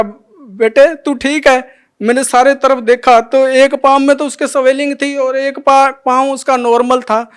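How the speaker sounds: relieved father holding back tears; moved to tears. Real, not acted. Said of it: "मेरी आंखें भर आई". (भावुक, भरी हुई आवाज़ में)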